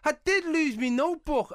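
high astonished disbelief; floored that the host knows about his lost notebook. Real, not acted. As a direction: marvel at it in a high voice. Stunned by quiz knowing details.

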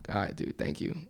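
voiceless, barely there; whispered goodbye. Retold or imitated. Nervous goodbye to the stranger, whispered. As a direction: breathe it out while backing away.

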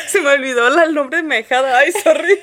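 embarrassed laughing apology. Real, not acted. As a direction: laugh through the words, sheepish. Forgot goddaughter's name, embarrassed.

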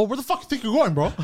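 high-pitched, indignant challenge; aggressive confrontation. Retold or imitated. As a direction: confront him, pitched well up. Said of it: "Like this, bro".

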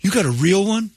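put-on high-pitched little kids chatting; child voice. Children talking about the elf.

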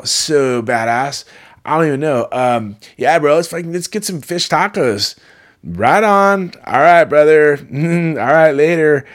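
high, loud, exaggerated California surfer-dude accent. Shown as a demonstration. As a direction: ham up a stoked California bro accent, stretching the words. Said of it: "try to do a California accent".

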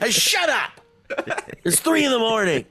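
high, annoyed voice of a woken neighbor; rudely awakened. Pretend. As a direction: yell at noisy neighbors in the night.

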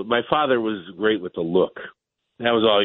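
ordinary talk, nothing marked

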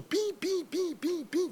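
pim-pim agudo e baixinho; notas de baixo imitadas. Retold or imitated. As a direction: imite notas erradas, agudas e leves. (imitando notas do instrumento)

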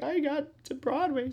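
high, slow, tearful squeak; hammed-up weepy high voice; mock crying. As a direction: wail it through imitated happy tears. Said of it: "like, crying. He's like".

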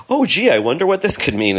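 high-pitched mock wondering; feigned ignorance. Hosts pretending not to know spoilers, mock-clueless.

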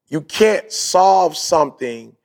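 slow, emphatic "You can't solve something"; deliberate repeat. Shown as a demonstration. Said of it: "make sure y'all catch what I'm saying".

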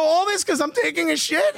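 high-pitched indignant question; retold outburst, voice jumping up; incredulous outrage. Man challenges police over bathroom visit, incredulous and high.

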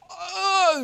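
high surprised oh of recognition; light up as it clicks; sudden recognition. Retold or imitated. Herkenning van acteur.

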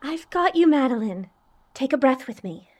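calm soothing. Acted. Calming friend in dark.

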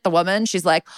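ordinary delivery

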